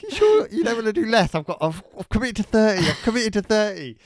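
high, loud, harried back-and-forth; acted doubtful exchange. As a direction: pitch it up, flustered and insistent. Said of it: "And they're like".

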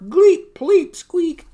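high, loud squeaky yelps; three punchy comic squeals; cartoon impact squeaks. Triplets knocked over by a skate, high comic squeaks.

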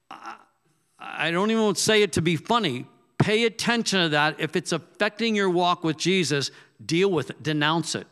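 ordinary talk, nothing marked

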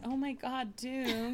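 overwhelmed by cuteness. Real, not acted. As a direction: melt at the cat's cuteness. Owner sees her pet.

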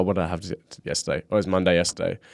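usual speaking voice

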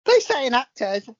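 high-pitched, hammed-up actress impression; Barbara Windsor. Medium channels a dead actress, high camp impression. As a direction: pitch it way up and play the darling. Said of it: "Would that be Dame Barbara Windsor?".

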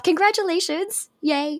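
bright, high cheer for the guest; excited congratulations. Real, not acted. Host cheering the guest on becoming a citizen, excited and high. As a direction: celebrate her with a big high "congratulations".

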